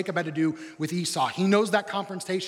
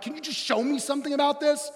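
exasperated plea; 10 semitones higher, 4 dB louder than usual. Retold or imitated. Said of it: "I'm like".

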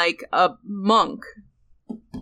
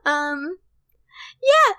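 high squeaky uwu voice; 12 semitones higher than usual. Shown as a demonstration. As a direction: pitch it way up, cutesy. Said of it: "it's really high up here".

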